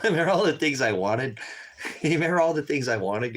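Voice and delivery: real laugh breaking into the line. Real, not acted. Laughing at his own dream.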